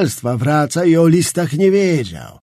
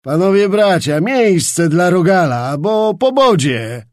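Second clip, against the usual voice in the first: calling out; 4 semitones higher, 6 dB louder than usual. A narrator's reading. Skrzetuski carrying Czapliński out, loud mocking call to the nobles. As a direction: announce it to the hall with glee. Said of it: "wołał".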